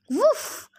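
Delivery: high, bright woof; happy dog bark. Acted. Hellhound greeting the satyr, happy high bark. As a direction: bark like a cheerful giant dog. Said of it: "Missus O'Leary said happily".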